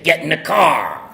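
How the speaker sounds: sponsor barking a slow order; gruff order. Retold or imitated. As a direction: bark it like a bossy sponsor.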